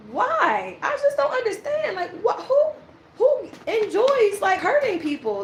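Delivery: high-pitched, incredulous questioning; baffled disbelief. Real, not acted. Tarot reader baffled why people enjoy hurting others, incredulous. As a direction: voice shooting up in bewilderment.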